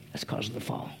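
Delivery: hushed, breathy whisper. Real, not acted. Preacher whispering the lesson to the congregation. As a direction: let it fall to a whisper. Hushed conclusion about death.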